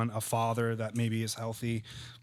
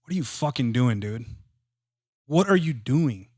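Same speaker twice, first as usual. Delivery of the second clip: louder, rising berating shout; harsh self-criticism; 6 dB louder than usual. Shown as a demonstration. Mentor showing the harsh inner voice, angry. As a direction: bark it at yourself like a drill sergeant. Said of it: "if you leave out the gentle part ... this is what it sounds like".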